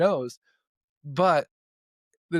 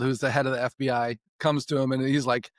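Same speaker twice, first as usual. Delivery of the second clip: ordinary talk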